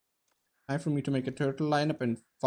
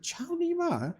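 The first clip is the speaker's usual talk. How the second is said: Ciao, Nima echoed much higher like a confused question; the Chinese player repeats the words back, baffled; puzzled repeat; slower than usual. Retold or imitated. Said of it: "And he said".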